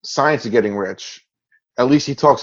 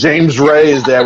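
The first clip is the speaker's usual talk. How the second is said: normal talking voice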